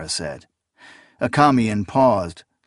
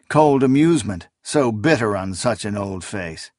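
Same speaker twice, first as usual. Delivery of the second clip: plain reading voice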